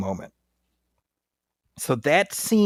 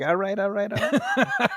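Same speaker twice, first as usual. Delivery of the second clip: higher-pitched, playful catchphrase imitation; Matthew McConaughey; 9 semitones higher, slower than usual. Friend quoting the film's star to co-host, playful impression. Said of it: "I was like, Mike McConaughey".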